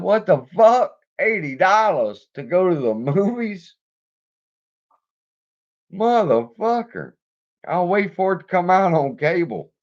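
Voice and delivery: high, loud disbelieving outburst; outraged shock. Retold or imitated. Reacting to the eighty dollar movie bill, outraged and loud. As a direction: blow up at the price.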